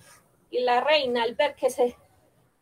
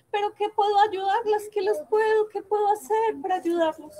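worried princess; 8 semitones higher than usual. Acted. Princess despairs for brothers.

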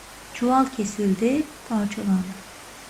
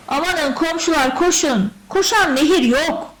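high, raised alarm call; calling for help; 5 semitones higher, 5 dB louder than usual. A narrator's reading. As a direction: summon the neighbours urgently. Family calls neighbours for help.

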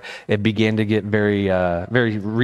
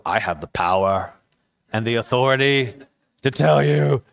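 lightly pompous, self-important authority; pompous claim. Pretend. Preacher showing the arrogant way to answer the girl, pompous. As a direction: puff yourself up a little. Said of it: "what you could do is go".

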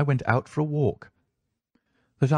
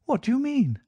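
high-pitched startled wife; sharply higher, questioning cry; 11 semitones higher than usual. A narrator's reading. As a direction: cry it out in indignant surprise. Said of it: "she cried".

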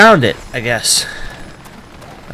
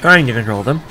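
talking through hiccups; holding back hiccups. Real, not acted. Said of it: "I have the hiccups all of a sudden".

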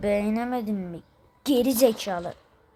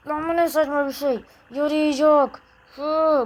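ordinary delivery

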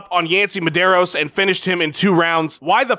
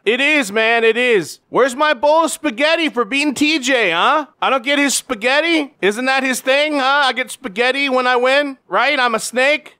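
higher, odd-timbred champ voice; mock Henry Cejudo; 5 semitones higher than usual. Champ answering a reporter, higher and awkward. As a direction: play the champ's awkward catchphrases. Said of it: "saying any of that right".

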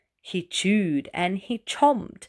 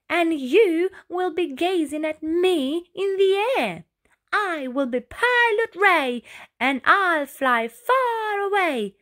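high, eager snail voice; Ray announcing; 8 semitones higher, 3 dB louder than usual. A narrator's reading. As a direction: boast it up high like a dreamy little snail. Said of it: "he announced".

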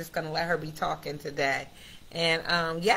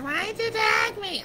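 much higher, mock whiny complaint; mock annoyed follower; 4 dB louder than usual. Followers complaining at the host about being tagged, high mock whine. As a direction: squeak it out like an irritated follower. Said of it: "be like".

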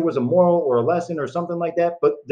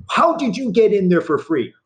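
retold yelling; 8 semitones higher, 3 dB louder than usual. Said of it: "She starts screaming and yelling at me".

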